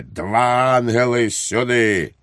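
calling out. A narrator's reading. Lord God summoning two angels at the door, calling out. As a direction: call the guards in with lifted pitch. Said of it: "відчиняє двері і гукає".

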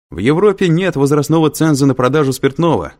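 high, loud heckle sustained to the end; shouted. A narrator's reading. Student calling out an answer to the lecturer, shouting. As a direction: holler it across the lecture hall. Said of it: "крикнул кто-то".

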